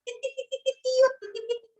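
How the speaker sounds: put-on very high squeaky child's cry of ti ti ti; punished boy's cry. Teacher imitates the punished boy crying out ti, very high. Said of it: "lo ponían así y gritaba".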